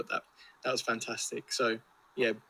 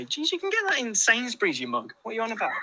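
mock outrage; 9 semitones higher than usual. Mock outrage at a beer pick.

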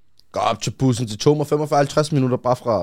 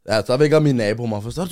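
ordinary delivery

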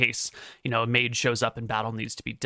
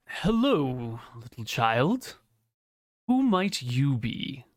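slow, lightly voiced Grand Inquisitor; a hint of a solemn inquisitor; deep-voiced Inquisitor; slower than usual. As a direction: draw it out slowly, addressing a child with measured authority.

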